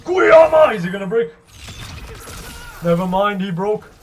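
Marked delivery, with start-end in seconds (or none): 0.0-0.7 loud name shout; hype shout. Real, not acted. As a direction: yell the name. Calling out the name again.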